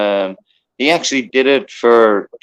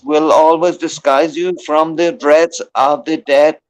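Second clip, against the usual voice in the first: a mock-solemn Irish blessing, pitched a bit higher; put-on Irish accent; 4 semitones higher than usual. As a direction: intone it like a hammed-up Irish elder. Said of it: "I tried to make up a good accent".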